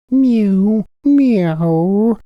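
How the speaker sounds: very high, loud, drawn-out mew; cat mewing. A narrator's reading. Simpkin the cat interrupts the singing mice with a high mew. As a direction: mew like a hungry cat at the door. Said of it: "interrupted Simpkin".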